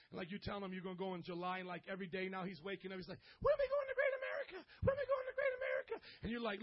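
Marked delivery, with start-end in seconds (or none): 3.4-6.1 put-on very high, fast pestering kid voice; hammed-up little kid begging to go; eager little brother. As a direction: imitate an impatient child, squeaky and rapid. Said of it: "you tell your little brother".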